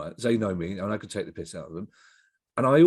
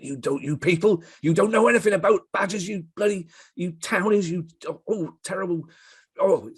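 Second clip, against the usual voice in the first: put-on high, loud, outraged West Country accent; Dave Ricketts' West Country rant; 9 semitones higher, 4 dB louder than usual. Mimicking a friend defending badgers, loud angry West Country accent. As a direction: explode in a thick West Country accent, furious. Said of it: "most preposterous West Country accent".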